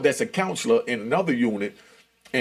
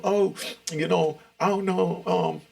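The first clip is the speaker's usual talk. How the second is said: higher-pitched imitation of a tattling woman; complaining woman; 8 semitones higher than usual. Counselor telling the case manager on him, complaining in a higher voice. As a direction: voice her complaint, pitched up with halting pauses.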